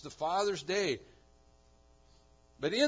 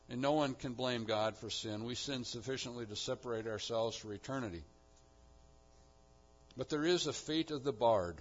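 ordinary talk

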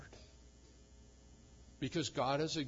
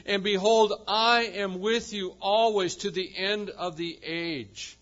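loud, ringing sermon proclamation; preaching at full force; 4 semitones higher, 10 dB louder than usual. Acted. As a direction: proclaim it to the whole congregation. Preaching the commission loudly.

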